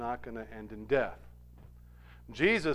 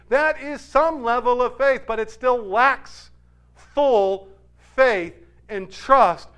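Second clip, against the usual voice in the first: loud, higher preaching, slowing on key words; full-force preaching; 9 semitones higher, 12 dB louder, slower than usual. Real, not acted. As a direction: drive the point home from the pulpit.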